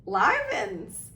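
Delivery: high, loud fake surprise; mock astonishment. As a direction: overplay the shock.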